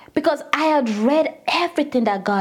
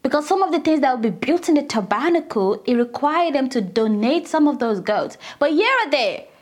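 ordinary talk

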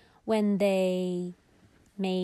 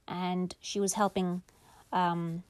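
usual speaking voice

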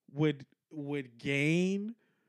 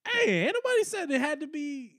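protesting; 9 semitones higher than usual. Real, not acted. Objecting to friend's conclusion.

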